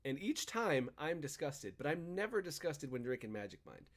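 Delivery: normal talking voice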